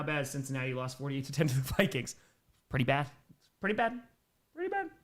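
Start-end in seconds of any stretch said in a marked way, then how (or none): 3.6-4.0 higher repeat of the verdict; pitched up. Real, not acted. Streamer judging a lopsided Vikings loss, pitched up. 4.5-4.9 tiny high squeak, softer; very high pitch. Real, not acted. Streamer judging a lopsided Vikings loss, very high and small.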